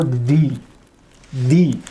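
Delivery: "दी" with tongue at the teeth, th plus ee; "दी" as dental th with long ee; theta-based D. Shown as a demonstration. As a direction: say "दी" softened toward th, between two D sounds. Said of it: "डी और डी के बीच में".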